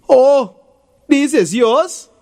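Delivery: loud, high, slow sarcastic "Oh"; a bully's hammed-up mock astonishment; mock surprise. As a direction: fake being surprised, grinning. Tony grins, feigned surprise.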